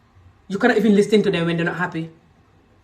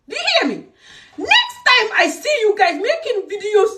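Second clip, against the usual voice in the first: angry shout; 13 semitones higher than usual. Real, not acted. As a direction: yell it as a final warning. Warning the men she addresses.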